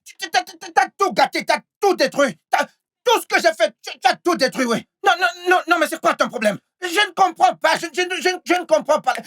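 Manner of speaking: high-pitched, stammering outrage; worked-up accusing stammer, pitched high; angry protest. Acted. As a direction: stumble over repeated words in agitated protest. Man quarrels over ruined business.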